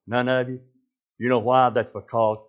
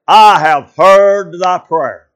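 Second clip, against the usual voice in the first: slow and solemn, louder; voice of God; 6 semitones higher, 13 dB louder, much slower than usual. Acted. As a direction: say it as God, each word weighed. Voicing God's answer slowly.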